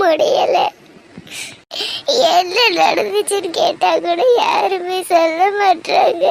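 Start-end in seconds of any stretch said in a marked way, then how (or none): 2.3-6.2 tearful. Acted. Daughter grieving over absent father.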